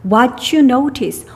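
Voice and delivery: each word drawn out very slowly; spelled-out teacher reading. Shown as a demonstration. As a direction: read it slowly, word by word, for the class. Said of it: "관계대명사 what이야".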